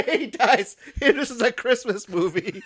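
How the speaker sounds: high-pitched, laughing through the words; can barely get it out for laughing; laughing incredulously. Real, not acted. Podcaster recapping a grim Christmas movie, incredulous laughter.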